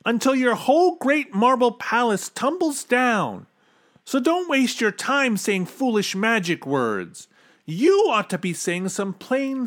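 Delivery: high-pitched scolding of a king; indignant shout. A narrator's reading. Bartholomew scolds king for foolish magic words, shouting. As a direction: let pent-up exasperation out. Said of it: "he shouted".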